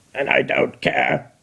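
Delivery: low, slow cold robot; flat dismissive machine reply; Dalek voice. Acted. Dalek answers coldly.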